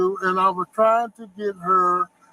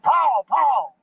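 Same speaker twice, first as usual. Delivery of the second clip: retold holler; 6 semitones higher than usual. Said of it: "I hollered and I said".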